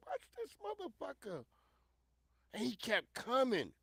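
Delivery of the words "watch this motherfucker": high alarmed quote. Retold or imitated. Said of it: "I was like".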